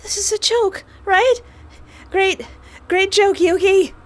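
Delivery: very high, tight disbelieving voice; panicked denial. Acted. Heroine refusing to believe her friend is dead, high panicked voice. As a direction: plead that it is a joke.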